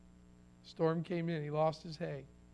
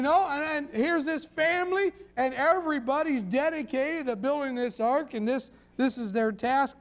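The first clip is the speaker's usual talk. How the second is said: pulpit preaching, voice up and pressing; rising revival-style preaching; fervent preaching; 6 semitones higher, 4 dB louder than usual. Acted. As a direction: build it like a sermon climax.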